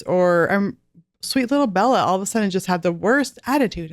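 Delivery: ordinary talk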